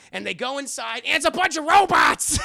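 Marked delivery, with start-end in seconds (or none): 1.0-2.4 high, very loud excited yell; shouted reveal. Real, not acted. Recapper reveals the club is full of robots, shouting. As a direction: yell the big twist out.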